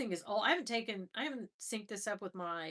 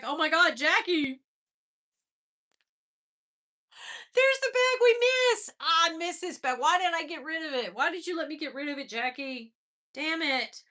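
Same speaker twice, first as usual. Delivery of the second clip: loud, high outburst of longing; excited lament; 7 semitones higher, 8 dB louder than usual. Real, not acted. Woman scolding Jackie over a lost bag, excited lament. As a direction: wail in playful outrage at a friend. Exclaims at photo of missed bag.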